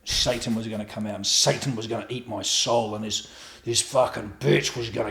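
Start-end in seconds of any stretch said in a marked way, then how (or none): none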